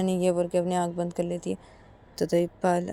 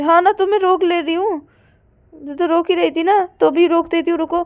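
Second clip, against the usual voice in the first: high, loud, determined retort; exasperated insistence; 10 semitones higher, 10 dB louder than usual. Acted. Partner moves to stop the parents, exasperated insistence. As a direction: insist heatedly, pitched well up.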